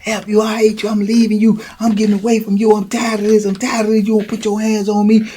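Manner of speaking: high upset tirade; fighting through door. Retold or imitated. Boo Boo yelling at Charles behind the door, high upset. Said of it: "you can hear 'em in there".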